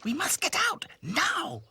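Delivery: urgent hiss. Acted. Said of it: "hissed Dotty".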